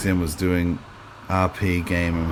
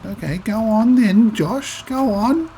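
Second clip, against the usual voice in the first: high-pitched put-on voice giving way; mock go-ahead reply; 13 semitones higher, 5 dB louder, slower than usual. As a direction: imitate someone letting a child go on, high and louder. Voices the reply in reenacted story.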